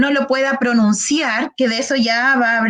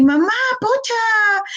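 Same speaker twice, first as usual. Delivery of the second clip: put-on high, slow little-child voice; mock toddler saying "pocha", much higher and slow; child with apraxia; 9 semitones higher, slower than usual. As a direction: pitch it way up like a toddler struggling. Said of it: "un niño con apraxia del habla me va a decir".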